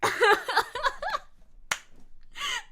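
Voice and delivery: high helpless laughter; shrieking laugh. Real, not acted. Laughing after swallowing fly.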